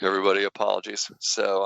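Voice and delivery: ordinary delivery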